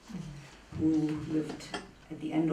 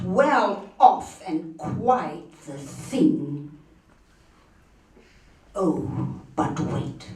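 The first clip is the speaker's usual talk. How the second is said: higher, emphatic, scornful recitation; dramatic poem recitation; 6 semitones higher, 4 dB louder than usual. Acted. Reader loving the poet's spitting at bourgeois society, declaimed with rising force.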